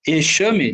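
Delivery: "Exame" said with a sh sound for the x; wrong pronunciation demo. Shown as a demonstration. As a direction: say "Exame" as "echame". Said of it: "Não, eu falo".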